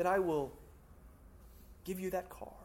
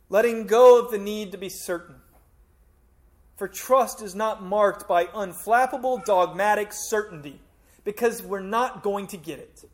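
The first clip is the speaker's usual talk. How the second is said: preaching at full force; 4 semitones higher, 10 dB louder than usual. Real, not acted. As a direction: hammer it home to the congregation.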